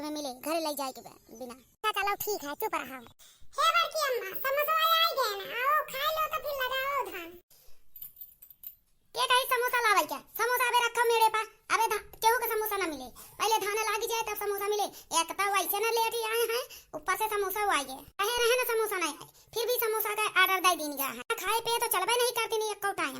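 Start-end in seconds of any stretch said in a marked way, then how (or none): 3.5-7.3 put-on high, raised voice of a child calling out; child calling mother. Child rousing mother to plant paddy, calling high. 9.1-23.2 scolding. Acted. Elder scolding about samosas.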